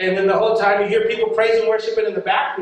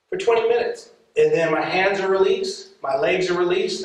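usual speaking voice

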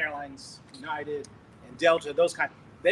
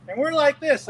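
higher and louder alarm; nearing the runway, higher and louder in alarm; alarmed exclamation; 8 semitones higher, 7 dB louder than usual. Retold or imitated. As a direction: cry out as the plane sways. Plane swaying near runway.

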